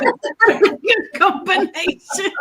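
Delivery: amused giggle at the snack pairing; laughing. Real, not acted. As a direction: laugh it out in disbelief. Giggling about odd snack combination.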